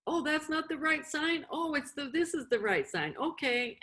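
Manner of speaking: high flustered oops, then relief; mock flustered self-correction. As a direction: play someone spotting their own mistake mid-sign. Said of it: "be willing to just go".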